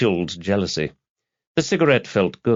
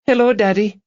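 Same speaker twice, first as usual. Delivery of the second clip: put-on very high, louder little-girl voice; young daughter; 13 semitones higher, 5 dB louder than usual. A narrator's reading. Daughter greets her father, high girlish voice. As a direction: greet a parent in a high child's voice.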